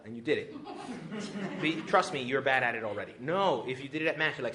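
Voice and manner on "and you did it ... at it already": usual speaking voice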